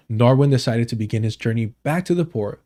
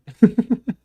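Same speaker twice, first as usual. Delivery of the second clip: nervous laugh; 11 semitones higher, 4 dB louder than usual. Real, not acted. Nervous laugh after getting spooked.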